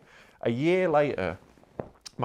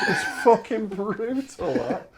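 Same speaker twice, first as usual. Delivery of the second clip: laughing; 10 semitones higher, slower than usual. Real, not acted. Laughing at his own story.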